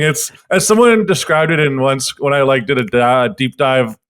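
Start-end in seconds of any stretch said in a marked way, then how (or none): none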